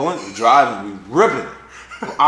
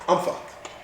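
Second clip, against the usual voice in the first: dismayed realisation; 10 dB quieter than usual. Retold or imitated. Retells realising he is trapped.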